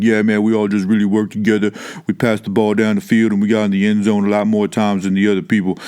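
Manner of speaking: post-game jock interview voice; a video-game football star, casual and proud; Madden player voice. Retold or imitated. Player answers the reporter in a mild jock voice. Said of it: "that voice comes from when I used to play Madden".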